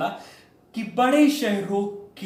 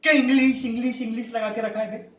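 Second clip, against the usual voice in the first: angry outburst; faster than usual. Acted. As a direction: blow up at being interrupted. Interrupted by interviewer demanding English, explodes. (झुंझलाकर गुस्से में फटकार)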